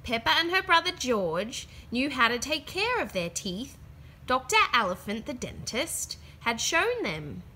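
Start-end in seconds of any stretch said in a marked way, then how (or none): none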